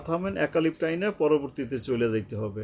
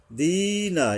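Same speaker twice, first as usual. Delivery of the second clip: higher দিনা with a long ee; one-alif elongation; 7 semitones higher, 3 dB louder, much slower than usual. Shown as a demonstration. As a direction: stretch the first vowel of দিনা. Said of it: "এক আলিফ টানতে হবে". (দিনা এক আলিফ টেনে)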